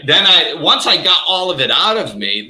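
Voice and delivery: ordinary delivery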